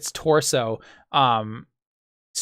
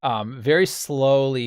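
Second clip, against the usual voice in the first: ordinary talk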